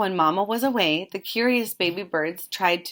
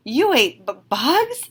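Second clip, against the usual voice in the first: stuttering disbelief; 6 semitones higher, 5 dB louder than usual. A narrator's reading. A bat stammers at Stella Luna's bug eating, stuttering disbelief. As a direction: stammer on bugs, rising much higher. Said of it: "stuttered one".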